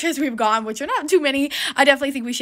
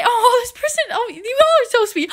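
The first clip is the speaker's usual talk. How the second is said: high-pitched, overjoyed gushing; thrilled and touched, pitched way up; really happy; 10 semitones higher than usual. Real, not acted. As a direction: gush with real delight, voice lifted high. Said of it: "I'm really, really happy".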